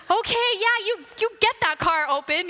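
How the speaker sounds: high-pitched mock-eager agreement; eager encouragement. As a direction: cheer her on, playing along. Playing along with excited woman.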